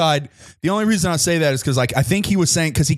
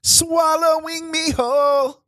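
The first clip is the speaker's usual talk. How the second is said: high voice; 11 semitones higher, slower than usual. Pretend. Mock dramatic line in song.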